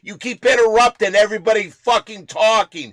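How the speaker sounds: furious yelling, high and loud; shouting. Real, not acted. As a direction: yell her off the show. Shouting her off show.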